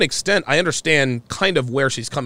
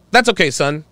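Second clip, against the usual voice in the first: ordinary talk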